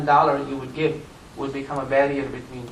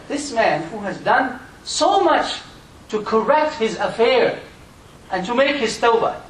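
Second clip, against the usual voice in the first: higher, slow, weighty preaching; slow emphatic preaching; 8 semitones higher, 4 dB louder, slower than usual. Acted. Preacher marvels at the repentant man, slow and raised. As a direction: lean on every word.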